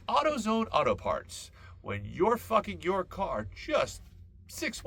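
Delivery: high, loud hammed-up commercial announcer; mock ad read. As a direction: sell it like a cheesy radio spot. Mock sponsor read for AutoZone.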